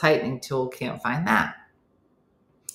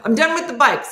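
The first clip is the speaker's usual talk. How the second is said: high, loud exasperated outburst; mock meltdown, voice jumping up; comic breakdown; 8 semitones higher, 6 dB louder, faster than usual. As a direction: blow up, utterly fed up. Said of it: "that's the beginning of the breakdown".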